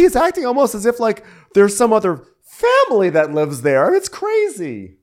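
loud, high-pitched mock rant; hammed-up complaint, rising to a high loud peak; exasperated venting. Acted housewife venting about her husband.

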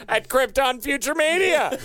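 mock company jingle voice. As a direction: finish the slogan in a hammed-up advert voice. Mock corporate slogan for company.

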